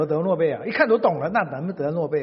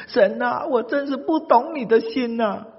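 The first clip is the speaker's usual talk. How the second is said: tearful cry; 8 semitones higher, 4 dB louder, slower than usual. Acted. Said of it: "约拿就痛哭失声".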